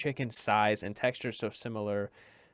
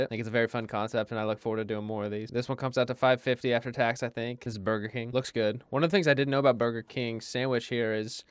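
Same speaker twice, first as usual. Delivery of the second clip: usual speaking voice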